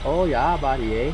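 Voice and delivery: lightly imitating a Canadian accent; mock Canadian accent. Shown as a demonstration. Teaching a friend to talk Canadian, mock Canadian accent. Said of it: "you have to talk like this".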